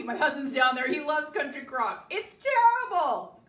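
loud teasing complaint. Real, not acted. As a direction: tease the husband loudly, peaking high on "It's terrible". Teasing her husband about margarine.

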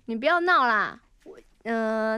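ordinary talk

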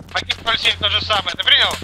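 usual speaking voice

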